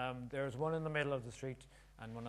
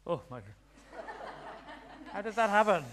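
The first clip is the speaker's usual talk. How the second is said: amazed laugh breaking through; real astonished laughter; laughing in disbelief; 7 semitones higher, 8 dB louder than usual. Real, not acted. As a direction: laugh out the question, stunned. Amazed at the card trick.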